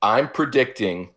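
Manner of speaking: usual speaking voice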